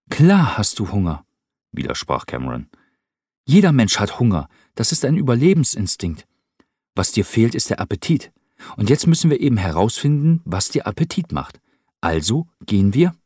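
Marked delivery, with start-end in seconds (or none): 0.1-1.2 viel höher, lauter, fröhlich drängend; junger Cameron, munter. Acted. Cameron voiced by narrator. 3.4-13.1 viel höher, lauter, fröhlich drängend; junger Cameron, munter. Acted. Cameron voiced by narrator.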